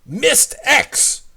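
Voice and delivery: high, loud exclamation; same shouting voice. Acted. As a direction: snap it out in the same off-side voice. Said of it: "a phrase spoken by that same voice".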